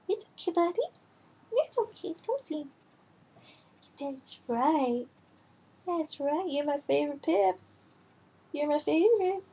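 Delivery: high, soft cooing to the cat; hushed high pet voice. Real, not acted. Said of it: "I talk to my cats like they're children".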